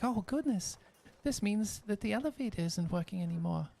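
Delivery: put-on high young woman's voice, fond and sad; high-pitched apprentice recalling her teacher; Jonas, tearful and wistful. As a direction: remember a lost mentor with a catch in the throat. Jonas realises elevator broken.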